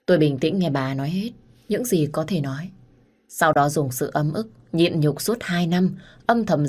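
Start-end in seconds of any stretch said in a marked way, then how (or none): none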